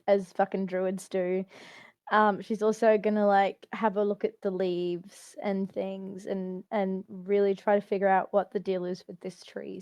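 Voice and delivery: ordinary talk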